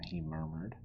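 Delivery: plain reading voice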